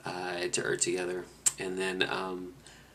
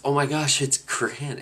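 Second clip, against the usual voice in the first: high, loud burst of recognition on "Oh my gosh"; suddenly realizing a lost friend is here; sudden surprise; 7 semitones higher, 6 dB louder, slower than usual. Real, not acted. Reunited with the group at a gas stop, a surprised shout.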